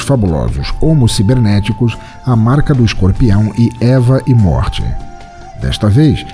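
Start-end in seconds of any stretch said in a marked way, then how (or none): none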